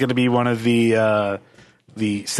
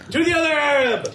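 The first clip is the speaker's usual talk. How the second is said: dramatic announcer; 14 semitones higher than usual. Pretend. Announcing next lead dramatically.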